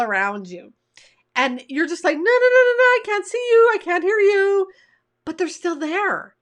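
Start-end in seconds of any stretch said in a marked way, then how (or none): 2.2-4.7 high, loud singsong denial; childish blocking-out. Acted. Coach acting out someone ignoring their emotions, loud mock denial. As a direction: drown them out like a kid with fingers in ears. Acts out refusing to face emotions.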